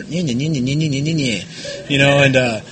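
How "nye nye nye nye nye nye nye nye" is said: sing-song nye nye taunt; mock the advice as childish babble. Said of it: "people were looking right at me".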